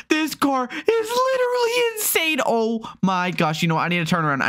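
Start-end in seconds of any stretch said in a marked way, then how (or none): 0.1-2.4 high, excited cry of disbelief; thrilled squeal. Real, not acted. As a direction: squeal it, amazed by the speed. Reacting to the car's acceleration.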